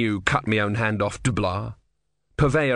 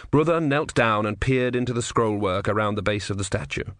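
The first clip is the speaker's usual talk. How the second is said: ordinary delivery, nothing marked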